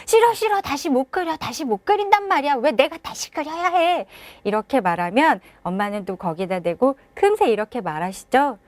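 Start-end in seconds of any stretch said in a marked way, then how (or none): 0.1-4.1 put-on tantrum child. Child refuses mom's offer to redraw, high whiny tantrum. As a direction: refuse like a stubborn five-year-old.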